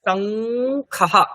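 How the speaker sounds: শঙ্খহা very slowly, humming long on the ng; syllable-by-syllable model. Shown as a demonstration. Teacher shows the joined letters, শঙ্খহা slowly drawn out. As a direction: hold shannng, then release kha-ha in শঙ্খহা. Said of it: "যুক্ত হয়ে গেছে".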